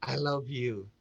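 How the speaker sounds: low, soft, tender declaration; sincere declaration. Shown as a demonstration. Coach modelling words to someone dear, sincere and soft. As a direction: say it gently and earnestly. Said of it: "Ya, Anda ucapkan misalnya".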